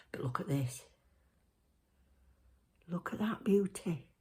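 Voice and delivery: hushed whisper. Real, not acted. Whispering while showing nail.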